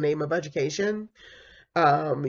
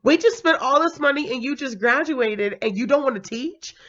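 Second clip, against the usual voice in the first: high outraged parent near tears; shocked mom; 9 semitones higher than usual. Retold or imitated. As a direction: play the stunned mother who paid for everything. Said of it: "she was shocked".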